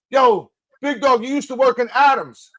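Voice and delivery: high-pitched call to someone passing; imitated hail. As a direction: holler it like a stranger spotting him. Retold stranger hailing him on boardwalk.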